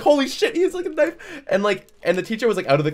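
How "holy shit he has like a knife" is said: shocked exclamation. Retold or imitated. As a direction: blurt it out in shock. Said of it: "all these other kids are like".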